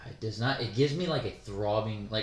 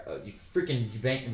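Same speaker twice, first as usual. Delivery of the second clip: ordinary talk, nothing marked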